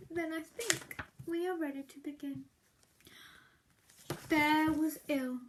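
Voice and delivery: ordinary delivery, nothing marked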